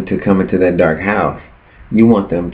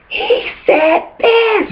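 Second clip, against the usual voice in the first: very high, slow, drawn out call; loud and clear; much slower than usual. Pretend. Calling out the tempter to everyone, very high and drawn out. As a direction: stretch it out so everybody hears. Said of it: "say it loud and clear".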